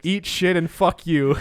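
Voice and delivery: reading the retort while cracking up; can't keep a straight face on the insult; laughing through the quote. Retold or imitated. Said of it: "And he said".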